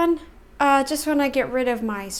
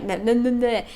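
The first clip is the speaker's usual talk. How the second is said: ordinary talk, nothing marked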